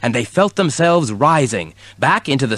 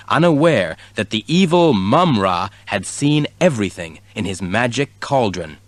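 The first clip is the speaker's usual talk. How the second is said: ordinary delivery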